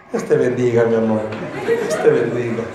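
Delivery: low, soft mock-pious voice; a hammed-up sugary meekness; meek husband. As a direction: play the doormat husband blessing his abuser. Husband mocked as meekly blessing wife.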